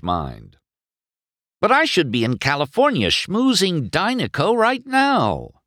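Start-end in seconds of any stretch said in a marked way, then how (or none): 1.6-5.6 high-pitched indignant whine; protesting. A narrator's reading. McQueen protests the delay.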